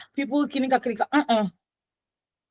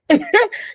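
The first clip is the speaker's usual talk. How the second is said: a quick bright laugh up high; short high laugh; 12 semitones higher than usual. Real, not acted. Laughing at snoozing her alarm, a quick high laugh. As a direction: laugh it off, amused at yourself.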